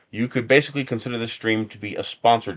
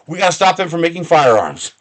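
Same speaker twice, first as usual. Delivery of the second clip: louder, higher sarcastic mock politician; mock official; 8 semitones higher, 8 dB louder than usual. Streamer mocking the politicians' excuse to viewers, louder and scornful. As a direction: throw out their excuse with scorn.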